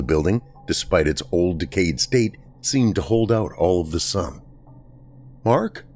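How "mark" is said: small frightened call. A narrator's reading. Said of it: "my voice too small".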